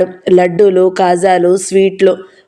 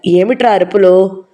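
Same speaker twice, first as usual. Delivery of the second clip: ordinary delivery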